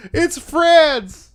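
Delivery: high, loud squeal of disbelief; real astonishment. Real, not acted. Streamer reeling at audience figures, squealed disbelief.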